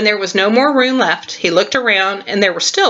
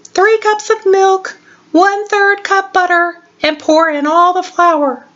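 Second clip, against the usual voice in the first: high, squeaky little mouse; tiny mouse voice; 9 semitones higher than usual. Acted. Squeaky reads the recipe aloud.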